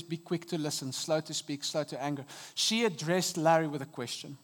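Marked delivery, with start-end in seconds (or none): 0.0-2.2 soft scripture. Shown as a demonstration. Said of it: "James one verse nineteen says".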